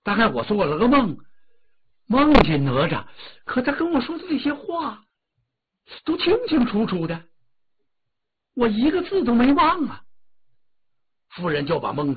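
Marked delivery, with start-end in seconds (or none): 0.0-10.0 高声的夫人, 急切地讲述梦境; 尖起嗓子说清梦里的话; 夫人讲梦. Acted. 夫人述梦. (提高音调的夫人口吻)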